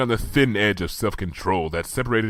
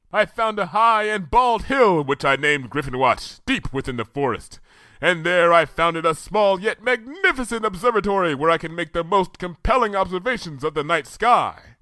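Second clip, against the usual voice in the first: boasting of a magnificent place; Luna's proud voice; 10 semitones higher than usual. A narrator's reading. Luna to Twilight, proud and regal.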